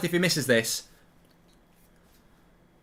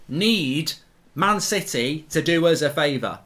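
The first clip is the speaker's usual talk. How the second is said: usual speaking voice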